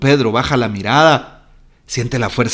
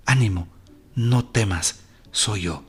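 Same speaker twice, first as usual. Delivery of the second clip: soft, gentle; 5 semitones lower, 6 dB quieter than usual. Acted. Closing soft repetition.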